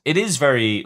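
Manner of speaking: usual speaking voice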